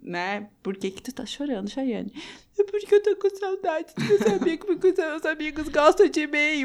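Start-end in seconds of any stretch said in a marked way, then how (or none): 2.6-6.6 put-on teary drunk reply. Retold drunk crying answer.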